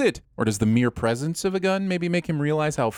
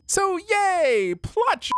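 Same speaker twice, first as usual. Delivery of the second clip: mock cheer; much slower than usual. Mock celebration of plot convenience.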